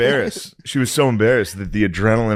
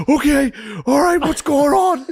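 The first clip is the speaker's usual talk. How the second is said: high, flustered questioning; flustered dad. Retold or imitated. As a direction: blurt it out flustered. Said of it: "He was like".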